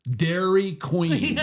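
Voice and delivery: Dairy Queen drawn out slowly; slow punchline. Retold or imitated. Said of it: "And she goes".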